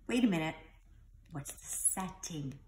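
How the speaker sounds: usual speaking voice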